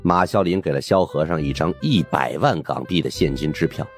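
usual speaking voice

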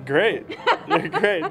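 laughing sarcastic "great"; dry, amused sarcasm about the ballparks. Real, not acted. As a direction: say great but mean the opposite. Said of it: "I can sense the sarcasm".

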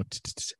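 quick unvoiced stuttered t sounds; stutter demo. Shown as a demonstration. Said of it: "So sometimes I'll go".

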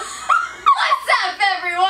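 high, loud squealing greeting; laughing shout of what's up; excited intro shout. Acted. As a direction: burst into the intro like a YouTuber. Energetic video intro greeting.

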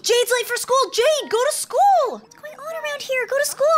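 high-pitched, playful panic; alarmed scolding. Pretend. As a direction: send a late kid off in a high, frantic voice. Scolding game teen late for school.

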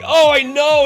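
high, loud mock panic; retold exclamation. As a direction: cry out in flustered agreement. Retold panicked reply to students.

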